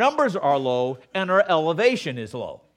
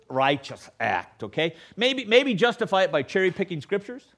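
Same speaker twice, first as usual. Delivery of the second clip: ordinary talk, nothing marked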